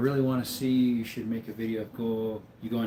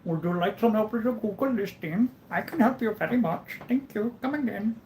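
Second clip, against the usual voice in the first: high, singsong mock Indian accent; Apu impression; 10 semitones higher than usual. Google listing caller offering help, hammed-up Indian accent. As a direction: put on a high-pitched Indian call-centre accent. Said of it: "You're an Apu impersonation is good".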